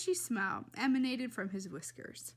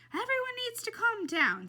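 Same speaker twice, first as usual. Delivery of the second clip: high, loud put-on woman's voice; Tootie taking charge; 12 semitones higher, 5 dB louder than usual. A narrator's reading. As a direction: call everyone to order, high and loud. Tootie takes charge of the chaos.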